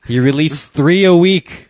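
high, slow, loud disbelief. Real, not acted. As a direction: say it amazed and loud. Loud incredulous remark.

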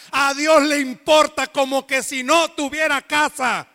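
fervent sermon, building up loud; preaching. Acted. Pastor insisting to the congregation, preaching with fervour. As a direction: press the point home to the church.

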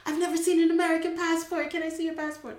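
high, loud and fast disbelief; incredulous. Retold or imitated. Questioning a stranger who wants her passport, incredulous. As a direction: protest in amused disbelief. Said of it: "I'm like".

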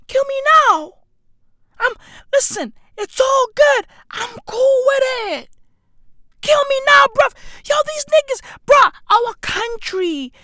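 very high, strained wail; mock despair. Vlogger giving up on his country, high wailing mock despair. Mock meltdown over sponsorship news.

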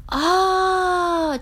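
long, high, loud ah; drawn-out high exclamation; yelled realization. A narrator's reading. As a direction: cry out as it dawns on you. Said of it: "Charlie yelled".

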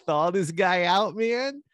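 high, loud dude voice; stoner bro voice. Retold or imitated. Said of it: "Todd's like".

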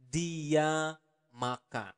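every word slowly and evenly; teacher spelling it out slowly for learners; slow teaching pace. Shown as a demonstration. Teacher to learners, slow and deliberate. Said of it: "teacher models slow phrase".

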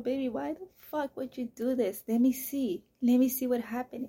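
put-on panicked parent raising the voice at a child; frantic confrontation. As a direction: demand to see it right now. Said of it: "I was just bulging my eyes out".

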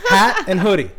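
indignant. Real, not acted. Indignant comic recounting wardrobe fight.

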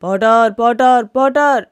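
yelled loud, over and over; loud shouting. Acted. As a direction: call the potter at full volume. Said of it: "shouting loudly".

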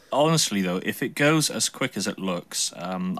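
usual speaking voice

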